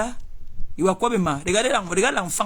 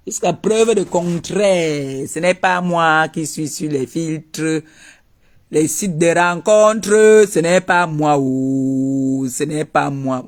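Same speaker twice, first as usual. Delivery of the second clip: slow emphatic denial; much slower than usual. Acted.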